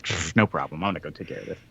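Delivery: low, offhand brush-off; acting out breezy low-voiced confidence; mock nonchalance. Retold Scrooge brushing off an obstacle.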